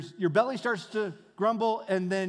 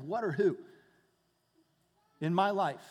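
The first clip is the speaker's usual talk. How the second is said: ordinary delivery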